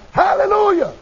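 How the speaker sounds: sudden high-pitched cry of praise; old father's joyful outburst; shouted hallelujah. Retold or imitated. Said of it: "he shouted out".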